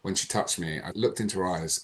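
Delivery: ordinary talk, nothing marked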